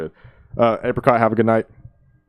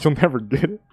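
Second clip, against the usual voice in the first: mock rapper line; 7 semitones higher than usual. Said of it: "the English part where he's like".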